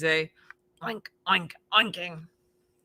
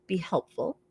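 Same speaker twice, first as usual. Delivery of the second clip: ordinary delivery, nothing marked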